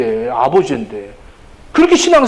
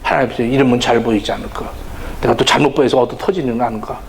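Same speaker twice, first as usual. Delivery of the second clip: lower, quicker worried inner voice; anxious servant; 7 semitones lower, 3 dB quieter, faster than usual. Retold or imitated. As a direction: fret like a scared servant. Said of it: "맨날 종같이 사는 거죠".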